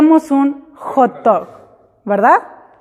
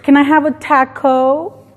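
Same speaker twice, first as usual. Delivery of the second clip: English accent. Shown as a demonstration. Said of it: "si dices".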